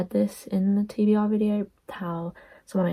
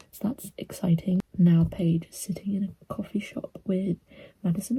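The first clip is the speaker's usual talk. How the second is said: whispering. Real, not acted. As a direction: keep it to a whisper. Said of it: "I'm whispering 'cause people are in bed".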